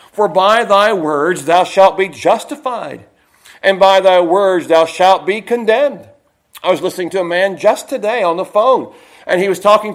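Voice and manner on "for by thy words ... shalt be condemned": scripture reading. Real, not acted.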